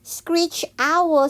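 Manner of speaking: plain reading voice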